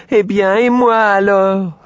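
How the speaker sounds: plus aigu, plus fort et plus lent; étirer la plainte du cochon; cri plaintif du cochon. A narrator's reading. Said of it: "en criant de toutes ses forces". (cri traînant du petit cochon)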